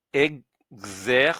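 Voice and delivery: Exercice, slow, the final s silent; slow full word. Shown as a demonstration. Teacher summing up the word Exercice. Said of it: "Donc, je résume".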